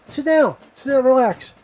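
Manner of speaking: high-pitched sergeant giving an order; imitated drill sergeant. Head drill sergeant tells scared recruit to sit, imitated high-pitched. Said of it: "He's like".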